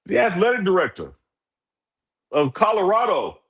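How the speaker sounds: high, loud reveal; hyped announcement. Real, not acted. Host revealing the guest to the co-host, high loud hype.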